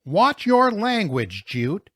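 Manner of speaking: high, loud, drawn-out scolding; calling out. A narrator's reading. Mrs. Zimmerman chiding the driver's language, calling out. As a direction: call a reprimand up to the cab. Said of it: "Missus Zimmerman called".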